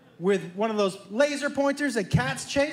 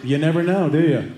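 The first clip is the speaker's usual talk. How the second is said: louder, low and ominous; 6 semitones lower, 7 dB louder than usual. Retold or imitated. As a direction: say it darkly, louder. Brother's ominous reply.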